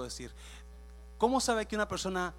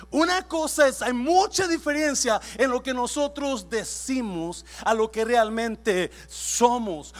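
preaching at full force; 7 semitones higher, 8 dB louder than usual. Acted. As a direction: hammer each line home to the church. Preaching point to congregation. (predicación a todo pulmón)

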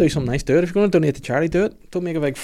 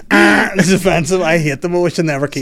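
mock offended judge; 6 semitones higher, 7 dB louder than usual. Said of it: "be like".

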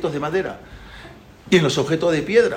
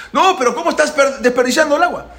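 high-pitched exasperated scolding; indignant protest; 10 semitones higher, 4 dB louder than usual. Acted. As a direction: scold him in acted disbelief. Egipcio insiste indignado. (regaño indignado, voz aguda)

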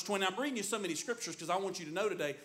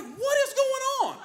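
high, loud bewildered husband voice; mock-confused husband with a laugh; clueless husband; 14 semitones higher, 7 dB louder than usual. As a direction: play the baffled husband, pitch way up. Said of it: "And I'm like".

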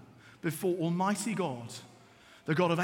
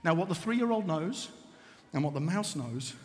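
ordinary talk

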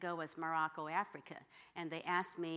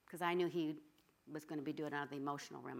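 ordinary delivery

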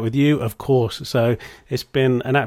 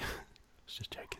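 whispered laugh; 23 dB quieter than usual. Real, not acted. Whispered joke after the aside.

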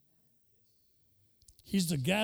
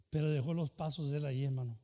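usual speaking voice

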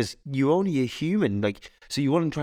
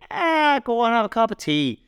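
high insistent housekeeper voice; Mrs Doyle; 11 semitones higher than usual. Retold or imitated. Mrs Doyle pressing tea on the priest, high insistent coaxing. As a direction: coax him, squeaky and pushy. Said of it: "He's like".

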